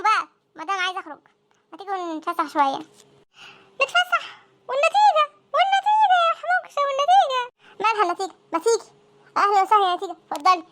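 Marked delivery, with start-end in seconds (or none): 3.8-7.4 outraged disbelief. Acted. Outraged at going out now.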